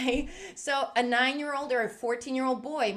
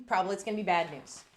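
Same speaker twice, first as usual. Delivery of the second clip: normal talking voice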